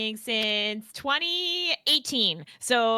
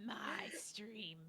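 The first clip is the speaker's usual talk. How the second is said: whispering; 23 dB quieter than usual. Real, not acted. Playful stream-claiming banter.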